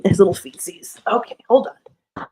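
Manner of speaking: usual speaking voice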